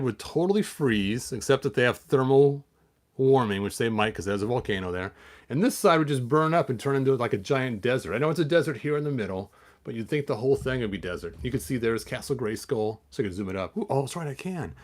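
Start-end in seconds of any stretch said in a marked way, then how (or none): none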